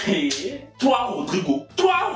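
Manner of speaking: usual speaking voice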